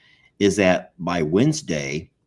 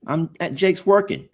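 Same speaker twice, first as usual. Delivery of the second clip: defensive reply; 11 semitones higher than usual. Retold or imitated. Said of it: "I'm like".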